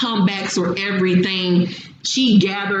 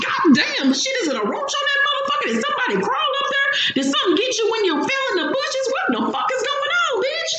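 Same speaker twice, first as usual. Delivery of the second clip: very high, rapid squealing questions; shocked disbelief; much faster than usual. Retold or imitated. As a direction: fire off outraged questions, high and fast. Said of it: "I'm like".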